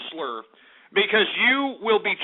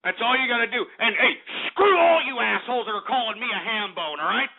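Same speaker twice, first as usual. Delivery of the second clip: ordinary talk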